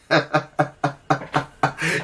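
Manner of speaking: a short, quiet chuckle; soft amused laugh. Real, not acted. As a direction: laugh softly to yourself. Laughs at cheek kiss image.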